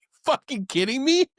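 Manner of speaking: high-pitched mock disbelief; incredulous student. Student to the instructor he was petrified of, incredulous and high. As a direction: play up the student's amazement at the question.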